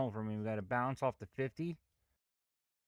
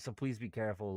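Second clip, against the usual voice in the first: ordinary delivery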